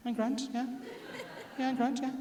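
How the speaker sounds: husband's strained high reassurance; nervous high. Retold or imitated. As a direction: squeak out a too-cheerful denial.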